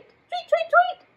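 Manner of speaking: very high chirpy tweet, tweet; bird tweets. Shown as a demonstration. Teacher showing kids how to tweet. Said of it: "Can you tweet".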